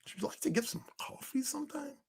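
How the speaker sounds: whispered, meek request for a date; mock timid whisper. Mocking a shy man asking a woman out, whisper. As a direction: breathe it like a shy nerd. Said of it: "if I talk like that with women".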